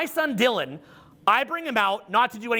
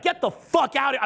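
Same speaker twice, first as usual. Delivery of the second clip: mild mock yell, higher-pitched; imitated angry outburst; 6 semitones higher than usual. Teenage son sends his dad away, mild imitated outburst. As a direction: quote a fed-up teenager, raising the pitch. Same outburst quoted again.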